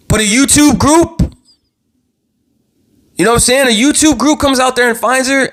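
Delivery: fired up, raising his voice at the sheriffs; worked-up yelling. Real, not acted. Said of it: "the only reason why I'm yelling".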